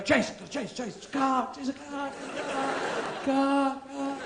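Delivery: high, frantic dog voice; excited dog. Acted. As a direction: yap it out like a car-mad dog. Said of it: "they go".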